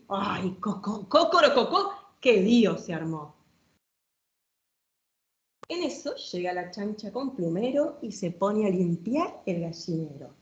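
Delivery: playful, sing-song read-aloud with bouncing rhyme; reading to children. Acted. Showing a children's book to the audience, lively storytime reading.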